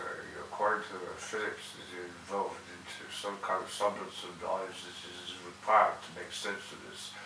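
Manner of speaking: hushed, low, slightly Irish lilt; soft Irish accent. Acted. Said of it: "it was soft, lilty, and, and, and, and slightly Irish".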